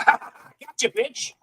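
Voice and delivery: high, taunting gotcha; mock gloating. Game companies gloating at the tricked customer, high and taunting. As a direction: taunt the fooled buyer.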